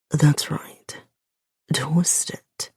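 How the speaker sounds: hushed male words pressed through teeth; through his teeth. Acted. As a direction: grit it out in a man's half whisper. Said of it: "He speaks through his teeth".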